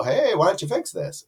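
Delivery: high-pitched playful call-out; mock complaint. Reviewer acting out an email to the seller, mock complaint. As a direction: pitch it up like a pretend nudge to fix something.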